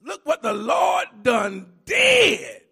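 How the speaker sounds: loud and slow, each word drawn out; slow triumphant preacher shout. Shown as a demonstration. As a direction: belt it like a preacher's climax. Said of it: "Can I say it like this?".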